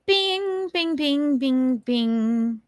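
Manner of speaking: descending string of ringing bings; ping like little bells, starting high and sliding down; imitated bings. Host fills the pause with chimes, playful descending bings.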